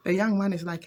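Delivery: plain reading voice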